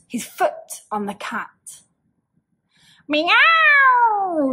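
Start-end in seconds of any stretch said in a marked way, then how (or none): 3.1-4.5 high, loud yowling meow; piercing cat scream. Acted. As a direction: shriek it like a stepped-on cat. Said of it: "screamed the cat".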